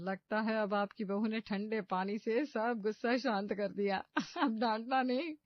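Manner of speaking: amused neighbor, teasing with a laugh at the end. Acted. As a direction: poke fun while holding back laughter. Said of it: "हंसी रोक नहीं पाती".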